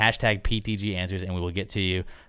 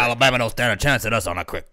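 Coach Orgeron; faster than usual. Acted. Host taunting Alabama as the LSU coach, mock Southern. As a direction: boast like a Southern football coach. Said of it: "You know Coach Orgeron from L S U?".

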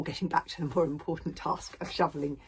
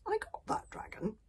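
a hint of a higher young voice; lightly voiced boy Hiccup. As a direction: lightly play the young grandson.

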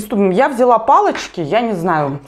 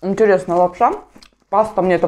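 muffled behind hand and napkin; wiping mouth while talking; speaking through a napkin. Real, not acted. Seen 4: Wipes mouth with napkin while speaking; chewing not clearly visible.

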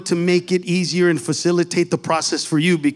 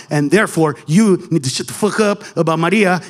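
ordinary delivery

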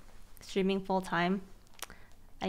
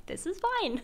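ironic this is fine; 10 semitones higher than usual. Real, not acted.

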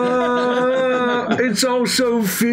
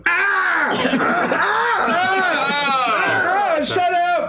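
pained screaming; 6 semitones higher, much slower than usual. Acted. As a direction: scream in pain and snap back. Character in pain, screaming at noise.

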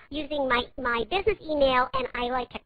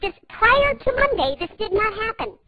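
higher and louder, exasperated; indignant; 7 semitones higher, 3 dB louder than usual. Acted. Caller scolds the tech agent, higher and exasperated. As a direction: insist it gets fixed now.

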